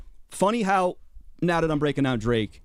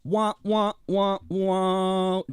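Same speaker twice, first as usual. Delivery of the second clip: higher-pitched sad trombone imitation; mocking wah-wah; 5 semitones higher, much slower than usual. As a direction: cut in with a drawn-out mocking wah-wah. Mocks the clip with trombone noise.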